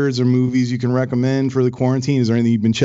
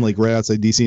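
ordinary talk, nothing marked

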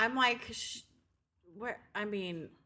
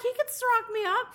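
high playful voice; 12 semitones higher, 4 dB louder than usual. Retold or imitated.

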